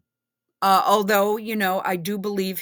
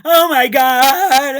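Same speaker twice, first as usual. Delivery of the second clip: loud, higher, drawn-out mock freak-out; going crazy, mocked; 4 semitones higher, 12 dB louder, much slower than usual. Streamer mimicking the man underlining things, hammed-up crazed wail. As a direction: wail it slowly like someone losing it. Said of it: "He's just going crazy".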